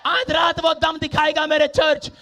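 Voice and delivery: high, driving sermon delivery; full-force preaching. Acted. Preacher promising his church God's power tonight, full-force preaching. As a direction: press the promise and story on the congregation.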